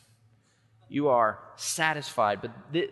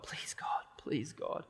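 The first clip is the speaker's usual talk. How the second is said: mumbled desperate prayer; 3 semitones higher, 4 dB quieter, slower than usual. Retold or imitated. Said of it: "all they can manage in their hearts is to mumble to God".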